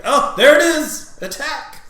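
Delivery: high-pitched cry of discovery; hammed-up, high excited alert; mock alarm. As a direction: call it out like a lookout spotting the target. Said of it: "everyone's gonna be like".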